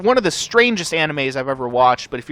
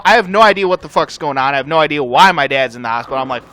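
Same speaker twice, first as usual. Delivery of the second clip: ordinary talk, nothing marked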